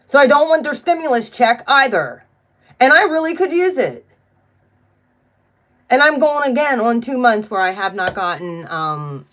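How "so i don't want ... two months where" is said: higher, louder, defiant insistence; real frustration, pitched up and forceful; indignant refusal. Real, not acted. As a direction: protest it with a raised voice. Rant about refusing stimulus resumes.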